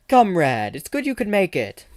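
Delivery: hammed-up Russian accent. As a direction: welcome him like a hearty Russian comrade. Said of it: "y'all really gotten accents".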